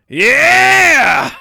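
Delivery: real full-voiced high scream of triumph; long, very loud celebratory yell; triumphant cheer. Real, not acted. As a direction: scream it out in victory. Players cheer the winning move.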